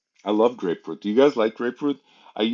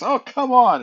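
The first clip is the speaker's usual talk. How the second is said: much higher, louder cry of disappointment; hammed-up frustrated soldier, pitched way up; exasperated; 9 dB louder than usual. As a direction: belt out a let-down groan of protest. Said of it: "and he goes".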